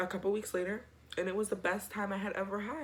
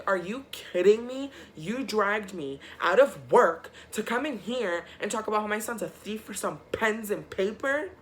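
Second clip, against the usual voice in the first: angry mom; 3 semitones higher, 5 dB louder than usual. Retold or imitated. Mom confronting principal angrily.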